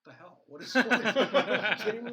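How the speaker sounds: baffled heroes. Retold or imitated. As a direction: shout it out in amused disbelief. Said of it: "Superman and Batman are like".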